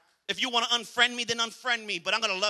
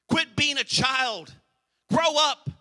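louder and higher, building to a shout; climactic preaching; 6 semitones higher, 5 dB louder, slower than usual. Acted. Preacher commanding the congregation to grow up, shouting.

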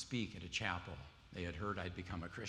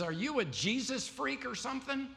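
incredulous clerk; 14 semitones higher, 7 dB louder than usual. Retold or imitated. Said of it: "He says".

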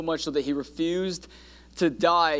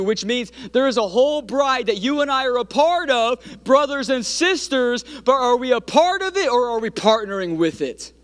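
raised, urgent pulpit cry; impassioned preaching; 8 semitones higher, 3 dB louder than usual. Real, not acted. Preacher challenges the congregation to partner with the bride, impassioned. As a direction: build high and drive the question home. Preaching climbs to full force.

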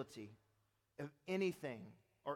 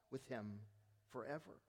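hushed; 8 semitones lower, 11 dB quieter than usual. Real, not acted. Tender hush at heaven's promise.

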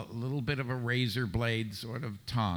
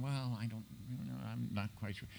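softer, lightly voiced hesitant suit; doubtful executives; 7 dB quieter, faster than usual. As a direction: hedge quietly like an unconvinced executive. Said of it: "the suits were going".

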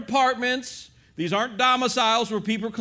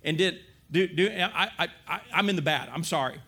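stammering, dwindling apology; fumbling apology; 4 semitones lower than usual. Retold or imitated. Gym-goer apologizes to silent stranger, stammering. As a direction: trip over the words and back down.

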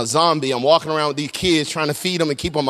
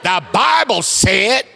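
very slow, high and loud preacher's cry; 6 semitones higher, 5 dB louder, much slower than usual. Shown as a demonstration. Congregation didn't say it with an attitude, so he shows full Baptist preacher attitude. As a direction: draw it out big, with attitude.

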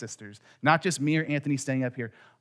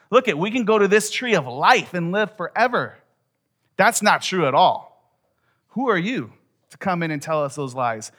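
loud, raised-pitch protest at a liar; indignant rebuttal; 6 semitones higher, 8 dB louder than usual. Acted. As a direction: stand up and forcefully refute the lie. Acts out standing up to serpent.

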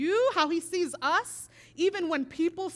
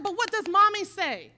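firm raised mom voice, pressing the question; louder insisting mom; 5 semitones higher, 6 dB louder than usual. Retold or imitated. Mother pressing her bullied son, louder and firm. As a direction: push him to answer, louder. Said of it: "And I said".

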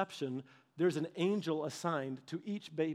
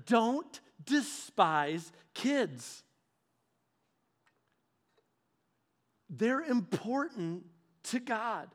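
very slow, earnest command; solemn God; much slower than usual. Retold or imitated. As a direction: draw it out gravely and gently.

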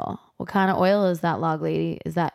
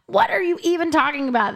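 much higher mock outrage; hammed-up incredulous question; mock indignant; 9 semitones higher, faster than usual. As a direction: squeal it like a baffled old man. Imitating Pete indignant.

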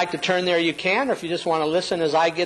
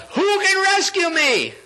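high, sustained plea for rescue; acted desperate appeal; Paul; 12 semitones higher than usual. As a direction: cry out for help on a high held pitch.

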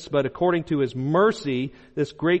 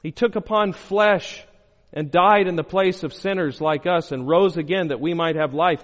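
ordinary talk, nothing marked

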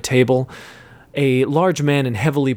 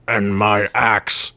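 slow low dwarf growl; gruff slow grumble; 3 semitones lower, much slower than usual. A narrator's reading. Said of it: "growled their short, bearded friend".

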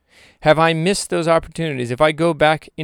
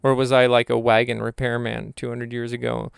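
ordinary delivery

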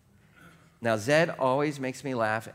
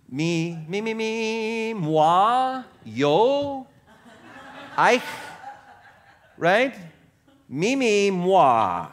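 high, loud whiny me me moi; mock self-centred voice; 6 semitones higher, 4 dB louder, much slower than usual. Pastor mocking self-ambition with a whiny me-me voice. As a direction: play a self-obsessed child chanting me.

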